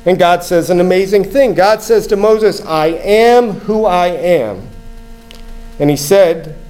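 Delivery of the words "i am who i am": very slow, weighty statement; slow, deliberate scripture quote; solemn declaration. Retold or imitated. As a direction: draw out each word as God's declaration. God's name solemnly quoted.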